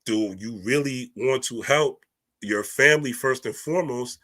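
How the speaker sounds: usual speaking voice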